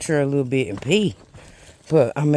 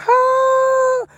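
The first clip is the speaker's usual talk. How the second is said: high excited gasp of joy; delighted oh; 5 dB louder than usual. Real, not acted. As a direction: gush over a gift. Finds trail magic cooler.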